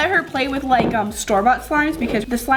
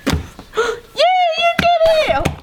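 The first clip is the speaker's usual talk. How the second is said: very high, drawn-out cheer; excited praise; slower than usual. Real, not acted. Mom to child making bubble, high excited praise.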